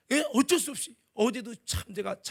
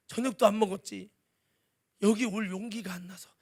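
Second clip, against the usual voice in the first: usual speaking voice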